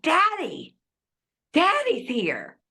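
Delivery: put-on high, slow, childlike calling out; a touch of a son seeing his dad; lightly voiced awed father. The father on his deathbed greeting his own dad, high and awed.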